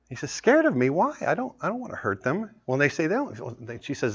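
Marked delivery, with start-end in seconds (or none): 0.3-2.5 put-on a small boy's voice pitched higher, puzzled; a little elephant boy, hurt and baffled; little Tembo. Little elephant answers his mother.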